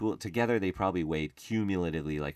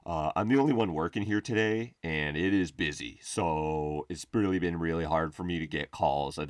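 a hint of a frazzled, louder phone guy; dumpster guy voicemail; 3 semitones higher, 3 dB louder than usual. Steve leaving a voicemail about being busy, lightly frazzled. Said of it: "and he's like".

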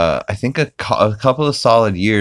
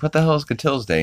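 baffled; 9 semitones higher, 3 dB quieter, faster than usual. Retold or imitated. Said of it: "He's like".

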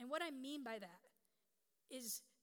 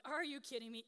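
mock exasperation; 3 semitones higher, 7 dB louder than usual. Tired mother as the crying continues, mock exasperated. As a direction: protest at having to get up.

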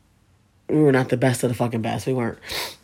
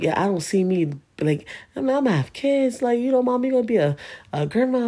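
lightly voiced mom, pitched higher; a hint of mom musing about never growing old; quoting mom; 9 semitones higher than usual. Mom telling her daughter she won't live long, lightly voiced.

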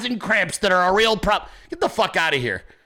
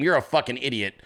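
ordinary talk

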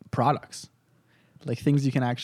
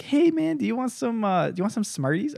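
higher sweet-talking big sister; sly sister voice; 8 semitones higher than usual. Retold or imitated. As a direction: lure him in innocently. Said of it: "My sister's like".

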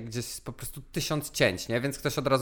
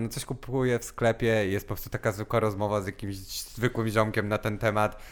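ordinary talk

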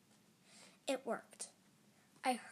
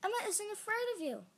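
high, quick, gleeful announcement; cheering; 10 semitones higher, faster than usual. A narrator's reading. Sibling tells monster the news, cheering. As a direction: crow it happily. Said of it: "I cheered".

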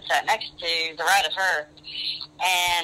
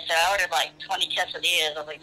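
ordinary talk, nothing marked

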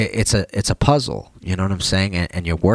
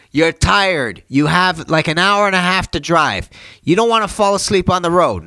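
retold dad yelling; 10 semitones higher than usual. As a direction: play dad insisting, pitch up. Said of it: "just started yelling at me".

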